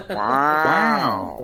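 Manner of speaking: laughing drawn-out wow; Owen Wilson wow. Retold or imitated. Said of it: "like fucking Owen Wilson".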